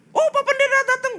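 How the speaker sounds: very high, loud, alien sing-song; mocking demon greeting the pastor; eerie high possessed voice; possessed woman's voice.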